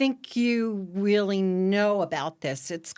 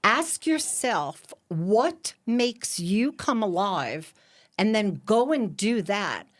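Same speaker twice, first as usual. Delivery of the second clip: slow quote reading; slower than usual. Acted.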